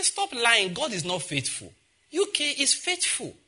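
high, slow, sneering jab; mocking retort. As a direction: scoff at them from up high. Mocking retort to worried caller.

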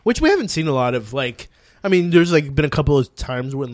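ordinary delivery

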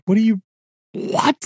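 high-pitched, stammered disbelief; mock-flabbergasted outburst; dumbfounded. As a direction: act out being too stunned to finish the question.

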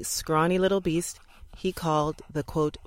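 ordinary delivery, nothing marked

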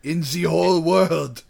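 light put-on German accent; German accent, lightly done; mock German accent. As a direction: play the wacky German dealer in a German accent. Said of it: "That's my German accent".

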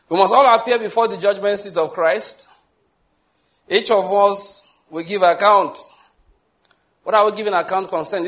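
preacher proclaiming judgment to his congregation, loud and high; full-force preaching. Acted. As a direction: thunder the judgment warning at the church.

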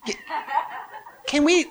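high, small imitation of a child; imitating a six-year-old's pleading question; cute little girl. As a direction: ask shyly in a little girl's voice. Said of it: "one little cute little six or seven-year-old girl".

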